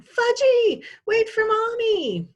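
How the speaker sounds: high, loud hollering mom; mother hollering, slow and high. A narrator's reading. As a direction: call after a runaway toddler at full voice.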